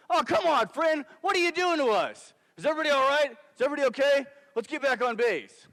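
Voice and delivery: high, loud hollering to the crew; urgent shouting. Retold or imitated. Lieutenant running up to the hit truck, urgent shouting. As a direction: call out at full volume. Lieutenant runs up after the blast.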